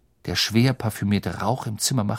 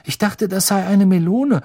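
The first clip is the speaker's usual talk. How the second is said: high, raised-voice sheepish objection; 9 semitones higher, 6 dB louder than usual. A narrator's reading. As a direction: protest awkwardly, pitched up. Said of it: "sagte Ron verdruckst". (hoch und verdruckst)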